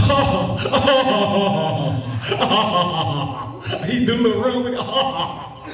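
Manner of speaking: high cartoon laugh in little bursts; imitated laugh. Comic imitating a cartoon character's laugh, hammed up. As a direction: do Eddy's run of laughs. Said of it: "He had to laugh".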